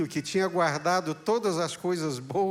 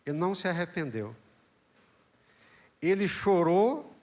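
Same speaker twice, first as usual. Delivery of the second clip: ordinary talk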